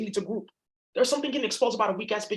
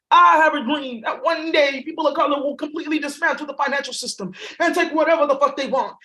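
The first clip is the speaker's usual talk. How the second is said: raised, ringing speech-maker's delivery; mock oration; 4 semitones higher, 5 dB louder than usual. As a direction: declaim it like a grand public speech. Parodies the famous dream speech.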